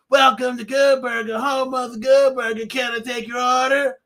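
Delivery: high, bright goofy cashier greeting. Retold or imitated. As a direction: greet the customer like a dopey fast-food kid. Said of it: "you get your classics in there".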